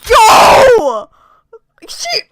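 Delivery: high loud yell; startled shout. Real, not acted. Gamer yells at the scene. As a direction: shout out in shock.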